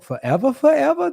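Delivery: high-pitched delivery of a comedian's catchphrase; quoted joke line. Retold or imitated. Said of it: "I think of his joke".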